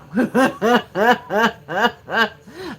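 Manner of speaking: big rising burst of laughter; loud laugh. Real, not acted. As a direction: crack up out loud.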